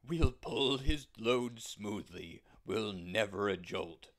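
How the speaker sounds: sturdy, dependable horse; steady horse. Acted. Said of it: "said the big brown horse".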